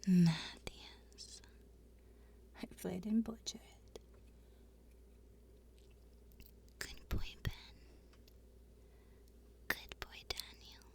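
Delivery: unvoiced whisper of praise. Real, not acted. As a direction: whisper it close to the mic. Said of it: "I can whisper you some names".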